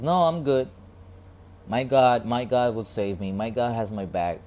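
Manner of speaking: high, loud, breezy brush-off; confident refusal. Retold or imitated. Man on the island turning down the rowboat, confident. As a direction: wave off the help, sure of God. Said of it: "And the guy says".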